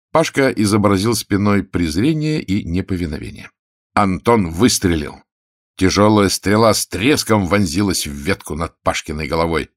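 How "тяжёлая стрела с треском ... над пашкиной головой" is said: ordinary delivery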